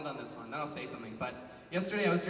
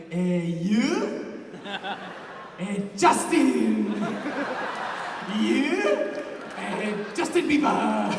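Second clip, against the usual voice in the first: loud halting foreign guy, laughing through it; imitating the stranger; 7 dB louder, much slower than usual. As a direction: mimic a stranger pointing at a photo, loud and unsure. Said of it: "and he's just like".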